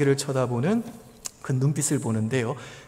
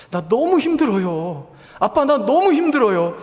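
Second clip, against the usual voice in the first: tearful plea; 8 semitones higher, 6 dB louder than usual. Retold or imitated. Daughter pleads to father, retold.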